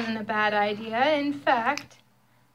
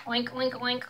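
mild pig snort; 4 dB quieter than usual. Acted. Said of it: "snorted Pig".

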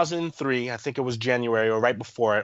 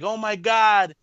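mother's dismay; 6 semitones higher, 5 dB louder, slower than usual. Retold or imitated. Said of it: "She's like".

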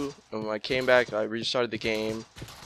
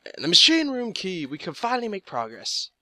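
high, loud triumphant find; thrilled at the discovery; excited; 7 semitones higher, 3 dB louder than usual. Real, not acted. Finally finds the key.

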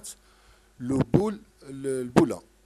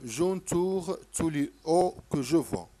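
slow dictation; 2 semitones higher, 3 dB louder, much slower than usual. Shown as a demonstration. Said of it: "تتقولي لي نعم ولا لا".

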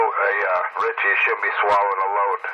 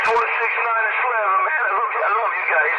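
falsetto; 11 semitones higher than usual. Pretend. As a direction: gush it in a high falsetto. Gushing falsetto on radio.

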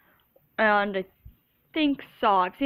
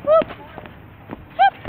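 high sudden yelp; startled whoop; 7 dB louder than usual. Real, not acted. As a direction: jump at a fright.

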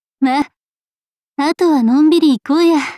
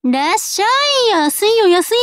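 street vendor's call; 7 semitones higher than usual. Acted. Clerk touting cheap goods to customers, hawker's call. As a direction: call out the bargains like a market seller.

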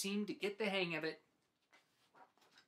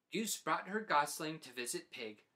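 plain reading voice

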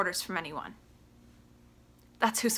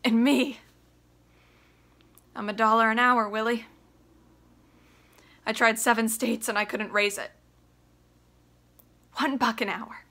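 loud, raw, high shouting; furious son; 3 semitones higher, 5 dB louder than usual. Acted. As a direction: tear into his father. Biff rages at Willie.

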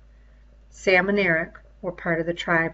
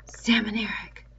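whispered call to the twins; gentle whisper; called very gently; 4 dB quieter than usual. Acted. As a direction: breathe their names out gently so no one else hears. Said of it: "He called out very gently".

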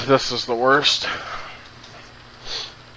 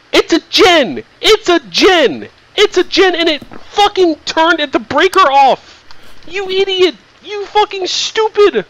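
much higher, louder triumphant shriek; high shrieking yell; 4 dB louder than usual. Real, not acted. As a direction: scream the discovery at the ghost. Yelling at ghost, high.

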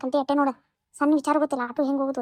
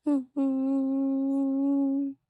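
long drawn whimpering hums; muffled sobs; much slower than usual. Acted. Sobbing again.

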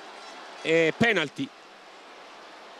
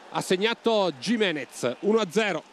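usual speaking voice

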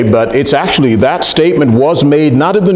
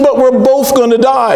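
much higher, a frog's alarmed protest; dismayed frog; 10 semitones higher than usual. Retold or imitated. Frog protests to the scorpion, much higher and alarmed. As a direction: cry out that both will die. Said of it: "He said".

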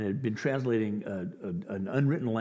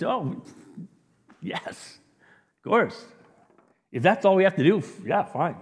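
acted breezy confidence, pitched higher; sure and convinced; 6 semitones higher, 4 dB louder than usual. James and John answering Jesus, sure and convinced. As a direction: agree as if it were nothing. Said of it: "are so sure and convinced".

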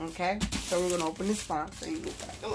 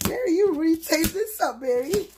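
higher and louder, playful exasperation; excited complaint; 10 semitones higher, 7 dB louder than usual. Real, not acted. Fighting the heavily taped box and teasing Mary, excited high voice. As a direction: tease her friend over all the tape.

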